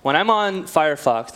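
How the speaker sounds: normal talking voice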